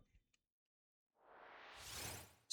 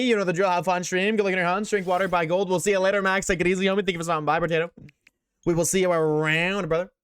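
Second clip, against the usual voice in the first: fast, high, loud sign-off to chat; hyped outro; 5 semitones higher, 4 dB louder, faster than usual. Acted. Streamer hyping chat during the end-of-stream sign-off, fast and loud. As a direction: rattle off shoutouts like a hype host.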